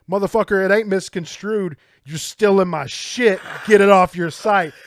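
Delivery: loud furious retort; angry yell. Retold or imitated. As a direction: yell it at them. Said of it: "people were replying like".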